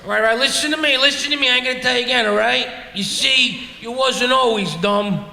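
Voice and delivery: Brooklyn guy. Retold or imitated. Brooklyn guy explaining "dumbfounded" to his girlfriend, Brooklyn accent. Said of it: "like only a Brooklyn guy could".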